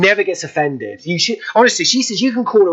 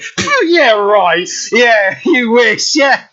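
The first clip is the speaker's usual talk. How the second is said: high, drawn-out mocking disbelief; mock scoffing; 6 semitones higher, much slower than usual. Bloke doubting he has met Mister Bean, mock scoffing.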